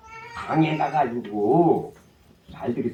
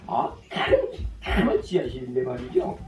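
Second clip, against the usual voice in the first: usual speaking voice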